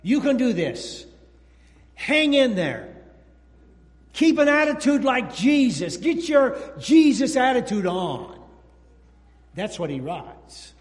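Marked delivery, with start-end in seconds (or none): 0.0-8.4 louder, higher rallying encouragement; encouraging Paul. Retold or imitated. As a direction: lift them up from the dungeon. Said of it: "He says something like".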